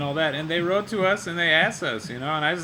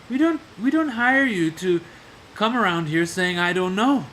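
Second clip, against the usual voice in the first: higher, louder lecturing boss; mock scolding boss; 3 semitones higher, 5 dB louder than usual. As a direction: scold the new employee from above.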